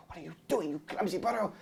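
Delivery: a touch muffled and hushed, voiced lightly as Puss; mild muffled grumble from under a beast suit; muffled under costume. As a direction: speak as if from inside a costume. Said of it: "from underneath the costume Puss going".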